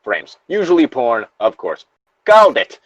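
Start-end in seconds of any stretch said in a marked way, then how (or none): 2.3-2.8 high, loud gloat at a correct guess; triumphant shout. Real, not acted. Reader gloating about predicting the porn twist, loud and triumphant. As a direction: crow it like you won the bet.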